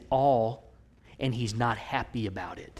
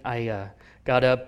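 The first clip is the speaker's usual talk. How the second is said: ordinary delivery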